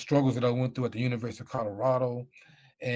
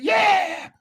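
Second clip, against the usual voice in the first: a high, loud joyous yell; excited shout; 7 dB louder than usual. Retold or imitated. Hearing he made the team, a loud joyful yell. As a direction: shout it out with pure joy. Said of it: "all happy and excited".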